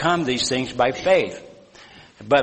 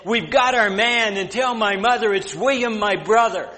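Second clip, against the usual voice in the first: shouted reply; 9 semitones higher than usual. Retold or imitated. John shouting from the boat to the shore, high joyful call. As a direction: shout the news home across the waves.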